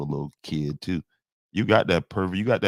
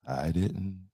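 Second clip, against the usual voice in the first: normal talking voice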